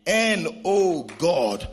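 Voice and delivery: slow, drawn-out pulpit delivery; emphatic preaching. Real, not acted. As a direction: spell it out slowly for the congregation, letting each phrase land. Preacher stretches out spelled-out wordplay.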